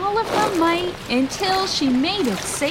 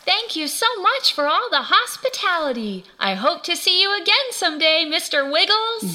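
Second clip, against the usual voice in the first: higher, sweetly polite lizard character; grateful little lizard; 4 semitones higher, faster than usual. Acted. Lizzie thanking the snake mayor, grateful little lizard voice.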